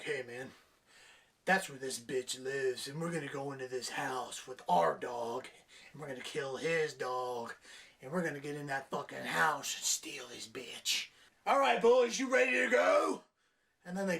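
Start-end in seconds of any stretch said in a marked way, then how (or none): 0.0-11.1 hammed-up tough-guy gangster; mock gangster. Reviewer voicing gangsters planning the break-in, low mock thug. 11.4-13.2 louder, higher thug rallying call; mock gang leader shout. Reviewer voicing gang leader rallying the boys, mock shout.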